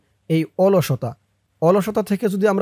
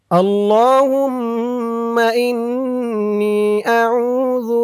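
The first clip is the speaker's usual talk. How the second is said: slow, drawn-out melodic recitation; Quranic recitation; 2 semitones higher, much slower than usual. Shown as a demonstration. Teacher reciting the dua for viewers, slow drawn-out chant. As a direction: stretch every vowel in a measured chant. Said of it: "আর আমি যেখানে যেমন টান দিব".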